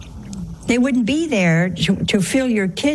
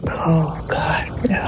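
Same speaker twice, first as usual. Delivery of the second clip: hushed, breathy mock horror; mock horrified; 4 semitones lower, slower than usual. A sister horrified by the family's table talk, mock horrified. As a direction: imitate a horrified onlooker under the breath. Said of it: "She was horrified".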